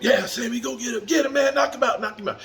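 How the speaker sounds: high excited yelling; coaches hyping. Retold or imitated. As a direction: pump him up, shouting. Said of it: "Coaches are pumping up".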